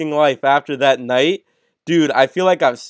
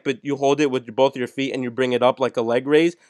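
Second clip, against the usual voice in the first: usual speaking voice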